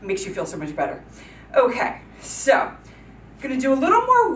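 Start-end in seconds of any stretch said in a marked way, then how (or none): 0.0-1.0 slow calming exhale. Shown as a demonstration.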